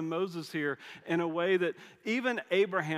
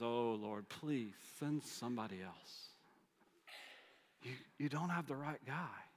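much softer, pleading; Moses begging; 3 semitones lower, 10 dB quieter than usual. Retold or imitated. Moses begging God to send somebody else, very soft. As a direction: plead almost under the breath.

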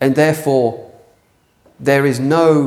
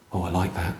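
hushed pleased reply; 8 semitones lower, 11 dB quieter than usual. Pretend. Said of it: "How much do you respond".

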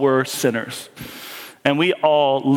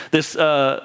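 ordinary talk

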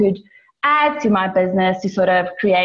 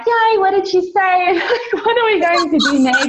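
much higher, bright eager retelling of the husband; excited husband; 10 semitones higher than usual. Retold or imitated. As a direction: gush it like he can't wait to hear. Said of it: "coming into the room all excited".

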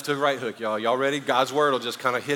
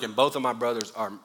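ordinary delivery

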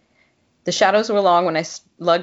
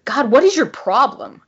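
louder, higher exasperated snap; exasperated outburst; 6 semitones higher, 6 dB louder than usual. A narrator's reading. Heroine losing patience with Will, exasperated outburst. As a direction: throw your hands up and let it fly.

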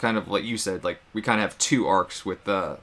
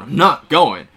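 loud defiant shout on "not"; angry refusal; 11 dB louder, slower than usual. Retold or imitated. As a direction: bark "I'm NOT going" back at him. Wade refusing, heated quarrel.

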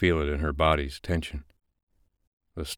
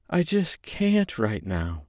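high, slow reluctant refusal; slow high female refusal; hesitant woman; 7 semitones higher, slower than usual. Acted. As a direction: push him away gently.

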